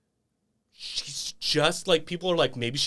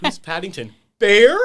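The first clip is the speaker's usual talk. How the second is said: comic baffled question, much louder at the end; mock surprise; 7 semitones higher, 11 dB louder than usual. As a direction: Bear shouted higher and louder. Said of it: "someone replaced it with".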